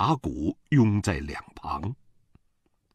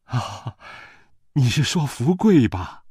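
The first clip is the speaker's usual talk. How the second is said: higher, ingratiating question; placating smile; 6 semitones higher, 5 dB louder than usual. A narrator's reading. Mother humours her angry in-law, with a placating smile. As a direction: ask with an apologetic smile in the voice. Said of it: "我娘陪着笑脸说".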